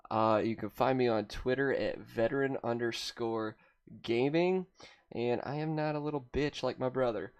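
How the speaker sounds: soft librarian monotone; hushed library-desk voice; librarian shush. Real, not acted. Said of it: "speak in the tone of a librarian right now".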